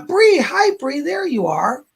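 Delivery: high, bright hello to a viewer; excited greeting. Real, not acted. Psychic greets a viewer in the chat, high and excited. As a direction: call out happily to a newcomer.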